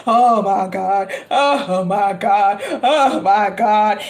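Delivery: hammed-up terror near Penn Station; mock-scared mumbling; mock panic.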